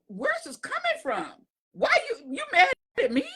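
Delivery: very high, baffled squeak; incredulous, pitch way up; bewildered. Retold or imitated. Asking the friend why she's mad, bewildered high squeak. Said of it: "I'm like".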